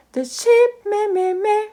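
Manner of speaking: high, loud bleating sheep; animal impressions. Shown as a demonstration. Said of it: "a lot of sounds from the, the animals".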